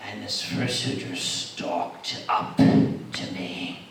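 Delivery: slow, low, hushed suspense; suspenseful storytelling. Acted. Telling how the fursuiter stalked up, slow ominous hush. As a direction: creep through it, nearly whispering.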